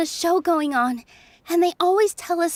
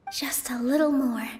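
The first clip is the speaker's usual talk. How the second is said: breathy self-encouragement under the breath; quiet inner whisper; whispered to herself; 4 semitones lower, 5 dB quieter than usual. Acted. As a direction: whisper it to steady yourself. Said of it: "I need to keep calm".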